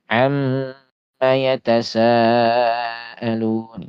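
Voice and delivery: "Amma" slow, mm held long; held tasydid. Shown as a demonstration. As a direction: hold the mm in "Amma". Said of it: "Ini sama seperti itu".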